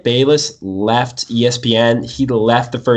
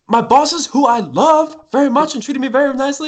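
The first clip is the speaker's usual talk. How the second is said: put-on baby voice; 13 semitones higher than usual. S0 imitating baby Stephen A.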